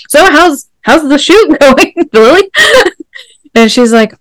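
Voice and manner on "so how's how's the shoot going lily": high-pitched, breaking into laughter; mock-casual question. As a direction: ask it high and loud, giggling through it.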